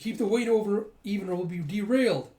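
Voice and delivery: high, loud acted instruction; urgent order. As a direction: call it out urgently over commotion.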